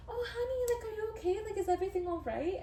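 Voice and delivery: mothering a worried passenger; sweet flight attendant. Retold or imitated. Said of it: "she was really sweet".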